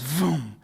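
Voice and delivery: vocal whoosh of a passing car; spoken engine vroom; imitated car zoom. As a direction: make the noise of a car speeding past. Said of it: "And the car goes".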